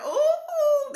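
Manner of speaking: very high, loud "ooh"; excited squeal. Real, not acted. Host spotting her family in the room, excited squeal. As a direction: squeal a long, piercing ooh.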